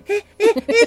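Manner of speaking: 高く強めに驚いてみせる; 動揺した彼氏を演じて聞き返す; 戸惑う彼の真似. Acted. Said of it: "ってさせておいて、". (大げさな戸惑いの声)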